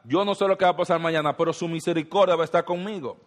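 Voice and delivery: cada vez más fuerte y rápido; confianza creciente. Acted. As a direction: declararlo con fe que va subiendo. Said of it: "Usted puede relajarse sabiendo". (repetido con fuerza creciente, más rápido)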